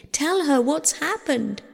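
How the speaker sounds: high, insistent entreaty; pleading. A narrator's reading. Persephone sends word to her mother, high pleading. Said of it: "she pleaded".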